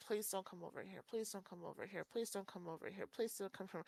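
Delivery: softly repeated plea, hoping he walks by; a quiet desperate chant to herself; pleading under breath. Retold or imitated. On a date, silently begging a stranger: quiet pleading. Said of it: "I'm like".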